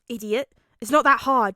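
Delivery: loud impatient scolding; gruff scolding. A narrator's reading. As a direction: bark it impatiently. Katsuki scolds.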